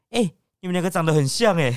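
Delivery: half-hearted shout from behind; imitating a clerk calling out; mock yell. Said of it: "有点类似类咆哮那种感觉".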